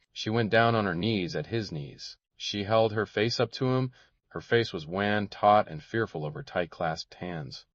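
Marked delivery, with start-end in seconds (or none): none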